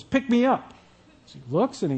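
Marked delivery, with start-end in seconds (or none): 0.0-0.7 higher-pitched little call from nearby; lightly voiced frog, pitched up; mysterious voice. Unseen frog calling to a fisherman, lightly pitched-up voice. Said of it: "he hears this voice that says".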